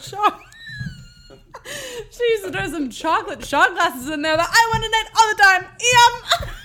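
half-shouting through laughter; laughing. Real, not acted. Laughing through chocolate story.

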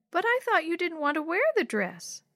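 put-on high-pitched puzzled little kid; small child's voice, questioning. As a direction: object like a confused little sister. Young Yo-Yo voiced in story.

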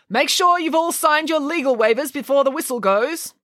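sustained high call to all the entrants. Acted. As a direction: race marshal's high-pitched announcement. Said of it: "called the vice principal".

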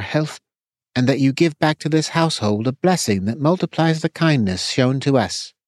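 reverent grace before a meal; solemn meal blessing. Acted.

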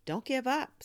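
put-on high, loud young girl's urging; eager encouragement. Girl encouraging hiccuping cousin, high eager urging. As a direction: urge him on brightly.

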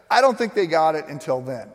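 ordinary talk, nothing marked

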